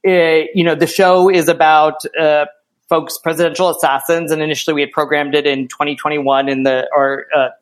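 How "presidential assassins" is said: ordinary talk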